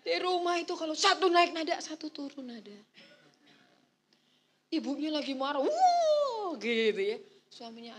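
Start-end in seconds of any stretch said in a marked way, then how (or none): 5.6-6.5 sharp high loud huff; a mother blowing up with a huff; mock furious wife's huff; angry huff.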